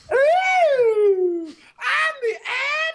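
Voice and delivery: high, slow, shrieking dragon. Pretend. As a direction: screech it out like a cartoon dragon. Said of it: "Let me see if I can do my ad dragon impression".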